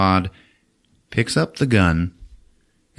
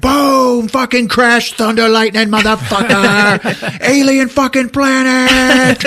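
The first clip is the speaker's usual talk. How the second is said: high, loud, drawn-out yell; hammed-up thunderous shouting; shouted storm effects; 6 dB louder, slower than usual. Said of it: "the storm hits, and it's like".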